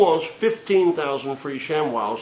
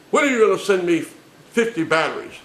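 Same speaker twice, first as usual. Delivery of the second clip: higher, louder demand; mock demanding caller; 6 semitones higher, 5 dB louder than usual. Caller demanding batteries from the agent, raised voice. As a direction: insist like an entitled customer.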